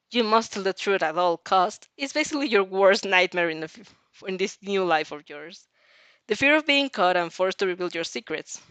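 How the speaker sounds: usual speaking voice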